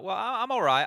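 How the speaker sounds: high, loud playful repeat; exaggerated all right. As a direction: ham up the greeting. Caster repeats greeting, exaggerated.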